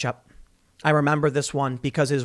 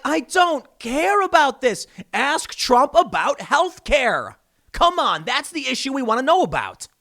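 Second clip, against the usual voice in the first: high, drawn-out exasperated plea; acted-out impatience, pitched well up; 7 semitones higher than usual. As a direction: groan at the TV in frustration. Acts out exasperation at TV debate.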